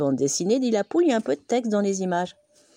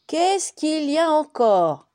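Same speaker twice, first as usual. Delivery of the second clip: slow, higher complaint of a dog just woken; grumpy dog waking; 10 semitones higher, 6 dB louder, slower than usual. Acted. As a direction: drag it out, freshly woken and annoyed. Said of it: "dit-il en s'éveillant". (chien grognon qui se réveille, lent et plus aigu)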